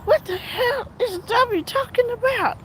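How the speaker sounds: high, disbelieving mock outburst; incredulous. Reacting to the streamer's dramatic call in disbelief. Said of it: "I was like".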